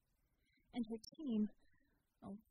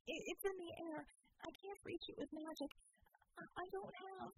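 put-on higher, stammering, near-frantic young woman; panicked Vicky; 8 semitones higher, 4 dB quieter than usual. A narrator's reading. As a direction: blurt it out while panicking, stumbling over the words.

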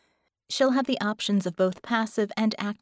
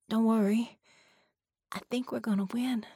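put-on reassuring young girl. Penny reassures, last line whispered.

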